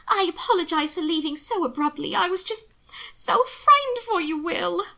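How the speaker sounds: high, fretful sister voice, climbing; frightened. A narrator's reading. Sister apologizes to her brother for leaving, frightened and high. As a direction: apologize with rising, tearful alarm. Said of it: "trembling there just inside his suite".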